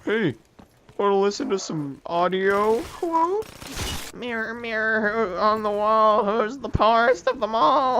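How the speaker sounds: high, sing-song taunting voice, mirror lines slow and loud; mocking game voice. Gamer mimics the game's audio taunting him, sing-song and loud. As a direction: ham up the annoying game announcer.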